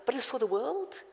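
usual speaking voice

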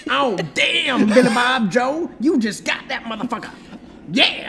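mock hillbilly hype man; backwoods sidekick. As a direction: whoop it in a Southern voice. Backwoods sidekick cheering the insult.

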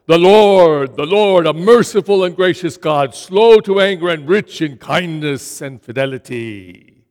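loud, ringing declaration; loud proclamation. Acted. The Lord passing before Moses, crying out loudly. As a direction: cry it out as God passing by.

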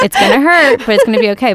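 hammed-up aggressive reassurance; mock aggressive. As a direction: push it out loudly, playing at being aggressive. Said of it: "super aggressive and".

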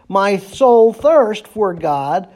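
"My soul thirsts for God" very slowly, weight on "thirsts"; slow scripture reading. Shown as a demonstration. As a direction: linger on the verse word by word. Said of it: "He repeats the thought in verse two".

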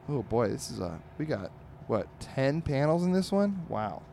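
ordinary talk

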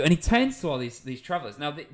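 normal talking voice

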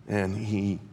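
ordinary talk, nothing marked

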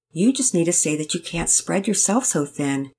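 usual speaking voice

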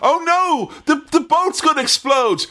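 high-pitched hammed-up alarm; mock panic. Acting someone on the boat about to explode, mock panic. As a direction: cry out in cartoon panic.

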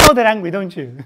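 normal talking voice

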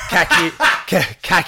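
"cacked" said with a short a, like "cack", laughing; mock the wrong "cacked", short a as in "back"; wrong pronunciation. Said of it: "Most people get the pronunciation wrong".

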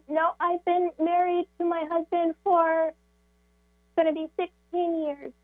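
normal talking voice

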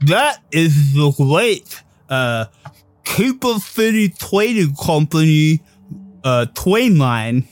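slow, lightly mumbled Stallone-like voice; a hint of a Sylvester Stallone drawl; Stallone-ish drawl. GM's official shows off the train line, a light Stallone drawl. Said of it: "getting more Sylvester Stallone".

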